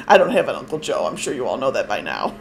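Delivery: real giggling through the words; low voice breaking into laughter; laughing. Real, not acted. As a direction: confess it while cracking up. Laughing after her prank call.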